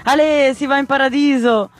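high, joyful cheer; happy amazement. A narrator's reading. Oniria flies off on her bed, happy and amazed. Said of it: "rise contenta e stupefatta".

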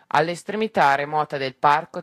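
ordinary delivery, nothing marked

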